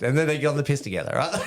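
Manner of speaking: high, loud and fast through laughter; amused, laughing. Real, not acted. Son tells how dad and corporal drank together, laughing. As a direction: laugh your way through the punchline. Laughs at own story's ending.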